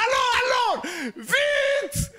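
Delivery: frantic high yell, laughing; panicked yell. Retold or imitated. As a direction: scream into the phone for help. Panicked call to emergency.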